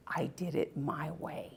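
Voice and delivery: hushed whisper. Real, not acted. Moved remembering father's song.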